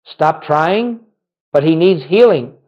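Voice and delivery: ordinary talk, nothing marked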